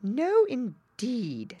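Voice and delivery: slow, drawn-out indignant wren. Acted. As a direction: draw it out with offended pride. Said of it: "said the wren".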